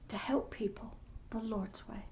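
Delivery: breathy hushed testimony; hushed whisper. Real, not acted. Preacher whispering his testimony to the congregation. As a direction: whisper it like a sacred secret.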